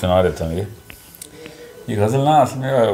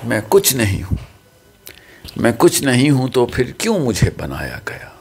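ghazal recitation; 4 semitones higher, 4 dB louder than usual. Real, not acted. Poet's son reciting his father's ghazal to friends, measured recitation. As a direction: recite poetry with weighted pauses.

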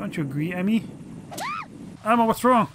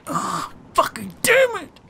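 imitating frustration; 9 semitones higher, slower than usual. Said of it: "He's like".

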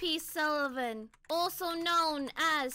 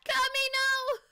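screaming for Kami; 10 semitones higher, 5 dB louder than usual. Real, not acted. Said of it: "me screaming for Kami".

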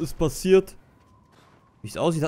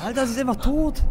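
higher, stunned cry; shocked outcry; 9 semitones higher than usual. Real, not acted. Streamer crying out when the mother dies. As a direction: blurt out the shock.

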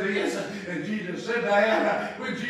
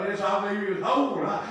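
usual speaking voice

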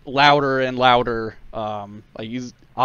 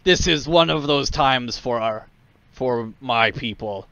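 rousing war speech; 3 semitones higher, 4 dB louder than usual. Acted. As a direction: stir up the crowd, rising. War leader rousing the crowd.

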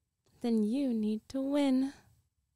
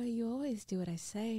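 dominant whisper. Acted. Commanding whisper.

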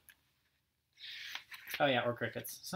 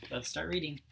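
ordinary delivery, nothing marked

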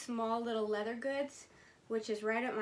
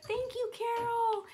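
high, slow, squealed thank you; gushing thanks; 12 semitones higher, 5 dB louder, slower than usual. Real, not acted. Thanking Carol with a delighted squeal.

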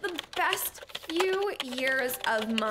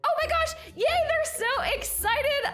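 high, delighted squeal of greeting; excited; 11 semitones higher than usual. Acted. Mom seeing her girls at the door, excited. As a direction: gasp it out high, stretching the excitement.

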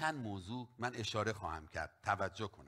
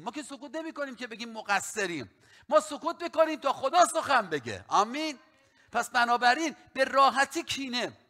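full-force preaching; 9 semitones higher, 8 dB louder than usual. Acted. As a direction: preach it out to the church. Pastor preaching to congregation.

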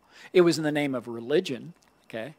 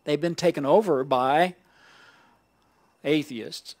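ordinary talk

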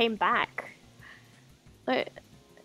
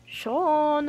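loud high call of "Sean!"; 5 semitones higher, 5 dB louder than usual. Acted. Gamer shouting a character's name at the screen, loud call.

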